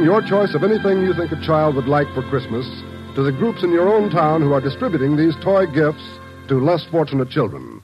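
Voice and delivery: normal talking voice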